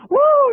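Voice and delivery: high, loud whoop; loud woo. Real, not acted. [name] whoops at the dancer's shimmy, high and loud. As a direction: whoop it out at the show.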